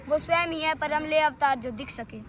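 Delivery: ordinary talk, nothing marked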